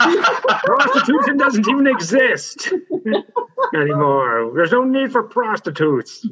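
high, laughing mock outrage; a high scandalized rant through a laugh; mock old grump. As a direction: ham up the cranky old man.

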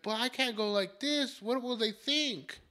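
high, drawn-out whine of protest; high, slow mock-indignant voice; protesting grandma. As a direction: imitate a fussy mom objecting.

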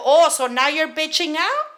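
loud, high retold challenge; heated, rising accusation; confrontational taunt. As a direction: act out calling someone's bluff. Acting out taunting her boss.

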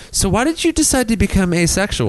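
high, ribbing mock question; teasing friends. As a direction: tease him like a buddy would. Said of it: "his friends go".

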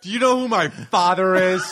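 high, loud privileged brat; mock spoiled kid. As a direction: threaten with daddy's power. S1 joins bratty student bit.

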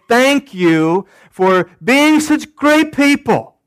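high-pitched, slow, exaggerated thanks; hammed-up gratitude; mock gushing thanks. Acts out what Paul doesn't say.

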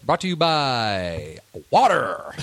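slow, drawn-out sponsor announcement; mock ad read. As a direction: read it like a cheesy ad tag.

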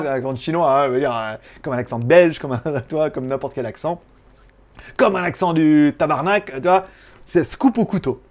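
ordinary talk, nothing marked

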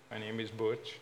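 ordinary talk, nothing marked

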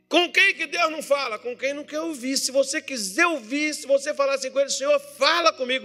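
high-pitched, insistent sermon delivery; fervent preaching. Real, not acted. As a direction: preach it with urgent conviction.

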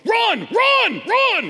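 very high, loud cheering yell; mock yell. Pastor imitating a father yelling run at his toddler, mock yell. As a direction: overeager dad hollering at a toddler. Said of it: "Jeff just starts yelling".

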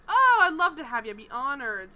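loud, high gushing start, then settling; retold eager welcome. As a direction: imitate a host gushing over a guest.

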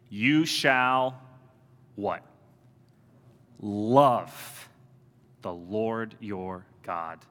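slow, weighty, each "all" leaned on; solemn scripture recitation. Acted. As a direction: intone it like a sacred creed, slowly.

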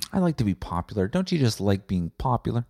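usual speaking voice

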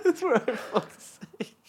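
squealing through laughter; words lost in a high giggle. Real, not acted. Laughing at friend's rant.

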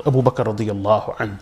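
ordinary talk

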